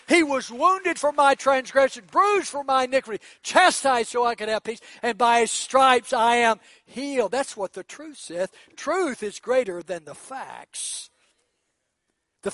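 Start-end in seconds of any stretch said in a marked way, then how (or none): 0.1-7.3 loud, high proclamation; preaching. Acted. Preacher declaring healing scripture to the church, loud. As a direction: proclaim the scripture with full force.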